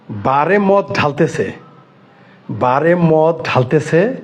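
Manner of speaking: drawn-out, emphatic delivery at half speed; slow, deliberate repetition. Real, not acted. Speaker hammering home that they pour liquor in a bar, slow and deliberate. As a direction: stretch every word out, very slowly. Repeats the damning detail slowly for emphasis.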